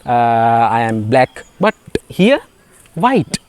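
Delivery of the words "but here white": playful high squeak. Acted. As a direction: squeak it out like a mock surprised joke.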